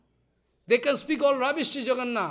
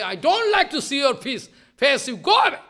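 louder, higher scolding; louder pretend rebuke; Radharani scolding; 4 semitones higher, 6 dB louder than usual. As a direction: scold him off loudly. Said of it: "Radharani speaking".

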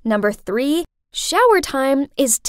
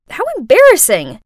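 ordinary delivery, nothing marked

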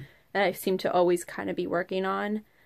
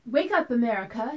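trumpeting mother; 4 semitones higher than usual. Acted. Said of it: "she'd trumpet to me".

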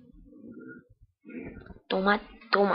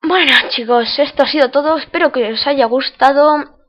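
loud, slow outro call; youtuber outro; 4 semitones higher, 10 dB louder, slower than usual. Acted. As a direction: sign off loudly to viewers. Loud outro asking viewers to subscribe.

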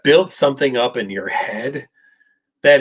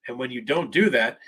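normal talking voice

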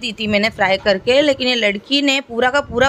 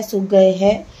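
usual speaking voice

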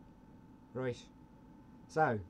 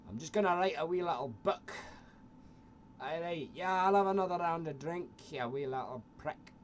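put-on higher, slurry drunk Scottish mate; tipsy Glaswegian pub talk; drunk Scottish accent; 4 semitones higher than usual. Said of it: "Scots mates, very drunk in a bar".

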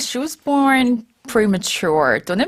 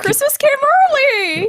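excited; 4 dB louder, much slower than usual. Acted. Excited exclamation of good luck.

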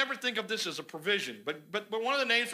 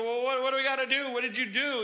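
quicker, higher mock-alarmed question; alarmed sailors; 5 semitones higher, 3 dB louder, faster than usual. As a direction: lightly act out worried sailors.

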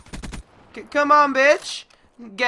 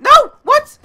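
loud, high-pitched disbelief; shocked yell; 13 dB louder than usual. Real, not acted. Gamer suddenly killed in the match, shocked yell.

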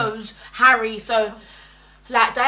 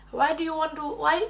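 high-pitched attempt at a South African accent; botched South African accent; 6 semitones higher, 4 dB quieter than usual. Imitating colleague asking her why, botched South African accent. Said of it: "I think she was South African".

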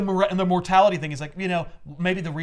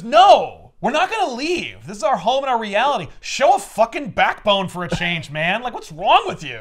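higher, louder mock scolding; scolding wife; 6 semitones higher, 5 dB louder than usual. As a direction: tell him off for being spineless. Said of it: "so she goes".

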